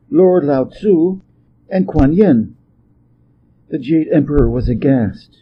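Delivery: ordinary delivery, nothing marked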